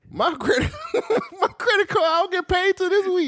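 laughing high squeaky. Real, not acted. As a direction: laugh through the words. Laughing about credit card.

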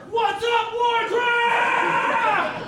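way up high, shouting to pump the room; hype-man shout. Retold or imitated. Comic to Warcraft fans, high hyped shout. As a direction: greet the arena like a rock star. Comic hypes the crowd on stage.

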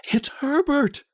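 old woman's high, voiced scream. A narrator's reading. Mother hearing the knock, high narrated scream. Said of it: "she screamed".

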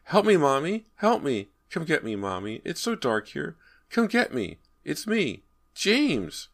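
put-on high, pleading child's voice; frightened little boy. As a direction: beg for rescue like a scared small boy. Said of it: "a little boy's frightened voice".

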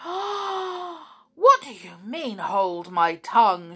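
long gasp, then loud high outburst; indignant shout. A narrator's reading. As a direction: draw out the outrage after a big gasp. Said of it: "shouted Sneery".

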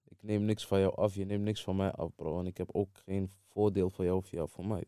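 normal talking voice

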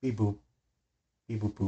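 low, soft robot beeps. Pretend. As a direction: beep like a little robot. Robot noise joke.

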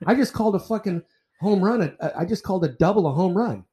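retold angry comeback. Umpire to coach after a blown call, heated and incredulous. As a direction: throw his own words back at him, incredulous. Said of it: "And I said".

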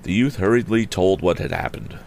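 plain reading voice

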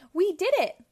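high, loud cheer of victory; cheerful triumph. A narrator's reading. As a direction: cheer it out for a little one. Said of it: "Pac-Man cheerfully told Baby Pac-Man".

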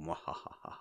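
low-key, soft sinister chuckle; Shadow-style mock laugh. All three laughing like the Shadow, soft mock-sinister laugh. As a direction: imitate a radio villain's hollow laugh. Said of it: "laugh like the shadow".

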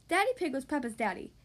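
usual speaking voice

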